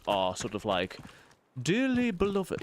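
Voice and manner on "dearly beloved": higher, slow, stately vicar intoning; mock-formal vicar opening a wedding; posh vicar. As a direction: intone it like an old-fashioned vicar. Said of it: "proper like that".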